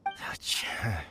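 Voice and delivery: soft unvoiced sigh; weary sigh. Childe sighing at Paimon's overreaction, a weary sigh. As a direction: breathe out a tired sigh.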